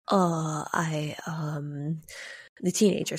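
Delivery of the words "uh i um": halting, low-pitched fumbling; hesitant. A narrator's reading. Teenager searching her mind before the stranger, hesitant. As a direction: trail through the fillers, unsure.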